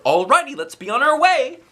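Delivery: high loud eager call; excited shout. A narrator's reading. Kid shouting to leave, high and loud. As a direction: call the family to go. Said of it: "I shouted, very excited".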